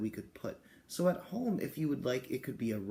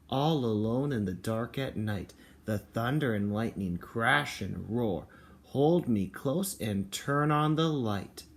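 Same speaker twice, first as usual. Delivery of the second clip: put-on reading to children; 3 semitones lower, 4 dB louder, slower than usual. Reading the picture book aloud.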